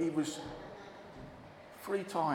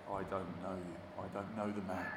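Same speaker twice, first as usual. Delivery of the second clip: hushed denial; 7 semitones lower, 12 dB quieter than usual. Retold or imitated. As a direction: drop to a guilty hush. Peter's denial told to children.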